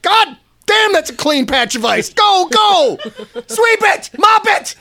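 loud, high mock shouting; excited curling fanatic. Imitating excited curlers shouting to sweepers, excited yelling. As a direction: yell it like the stone's life depends on it. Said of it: "how excited these people are".

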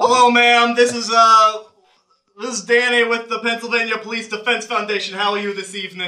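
put-on phone solicitor sounding like a cop; police voice. Shown as a demonstration. Former phone solicitor greets old lady for donations, police voice. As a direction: pitch it up into an officer's fundraising patter. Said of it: "Say it in your police voice".

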